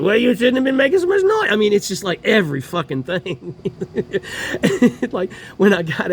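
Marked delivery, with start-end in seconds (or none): 0.0-1.5 higher, louder snide imitation of the officer; mocking cop. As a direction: sneer his excuse back at him. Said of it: "He said".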